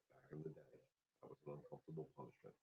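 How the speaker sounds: fast whispered "that was an uncomfortable punishment"; whispered aside. Real, not acted. Trainer confiding to viewers about old punishments, whispered. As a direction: breathe it out as a quick secret aside.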